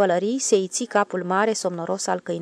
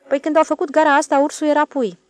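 eager; 8 semitones higher, 8 dB louder, faster than usual. Acted. Switchman eagerly explains.

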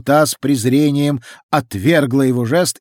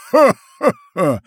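gloating laugh; 10 semitones higher, 4 dB louder, much slower than usual. A narrator's reading. Fountain creature laughs.

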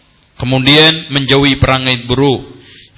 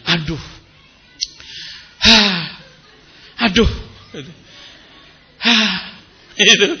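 exasperated sighs with long pauses; mock complaining; 6 semitones higher, 5 dB quieter than usual. Preacher imitating a wife who keeps complaining, mock exasperated sighing. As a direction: huff and moan as if fed up. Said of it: "diselesaikan dengan ngeluh".

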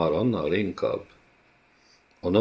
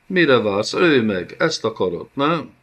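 louder, higher desperate challenge; defiant cry; 5 semitones higher, 5 dB louder than usual. A narrator's reading. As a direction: dare him to kill her. Princess defies her captor. (kétségbeesett, dacos kiáltás)

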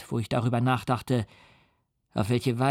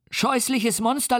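put-on terrified child; 9 semitones higher than usual. A narrator's reading. The boy threatens the monster with his father, high and scared. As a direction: shriek each insult like a frightened child.

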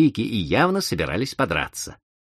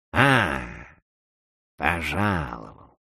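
ironic; 3 semitones lower, much slower than usual. Acted. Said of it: "иронически встретил его дедушкин".

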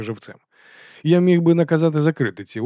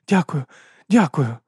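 higher voice, short repeated thanks; grateful young woman; 5 semitones higher, 3 dB louder, slower than usual. A narrator's reading. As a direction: thank the rescuer in a raised voice. Abena thanks her rescuer.

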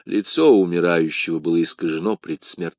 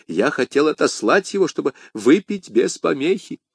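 higher, scared servant's voice; acted timid admission, pitched up; fearful confession; 7 semitones higher than usual. A narrator's reading. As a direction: confess to your master in fear. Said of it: "покаялся Фурро, щёлкая зубами от страха".

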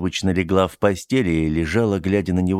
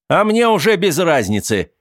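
higher, louder outburst; shouting; 11 semitones higher, 5 dB louder than usual. Acted. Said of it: "выкрикнул он".

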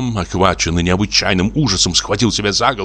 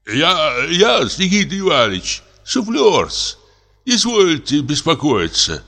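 high, meek servant's voice; timid old prompter; 10 semitones higher, slower than usual. A narrator's reading. Old prompter reassuring the frightened comic, high meek voice. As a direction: answer humbly, eager to calm him.

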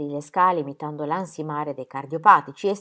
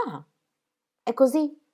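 alzato di tono, incredula; esclamazione stupita; 8 semitones higher, 4 dB louder than usual. A narrator's reading. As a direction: come se non ci credesse. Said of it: "esclamò infine". (esclamazione incredula, più acuta)

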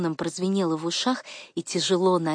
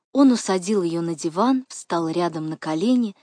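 usual speaking voice

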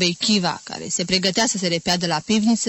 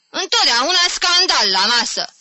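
higher and louder, exasperated; upset outburst; 8 semitones higher, 5 dB louder than usual. A narrator's reading. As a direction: complain loudly about the quarrel. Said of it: "zise supărată tanti Caterina". (exasperată, mai tare)

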